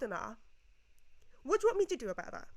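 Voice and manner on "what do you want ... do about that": loud, high, rapid challenge; indignant rapid-fire question; exasperated protest. Acted. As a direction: throw it back at them, fast and raised. Arguing back at imagined critic.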